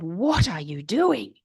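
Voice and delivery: slow, pointed disbelief; retold challenge. Midwives confront the newcomer with steaming water, retold slow disbelief. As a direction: question her slowly, imitating disapproving colleagues.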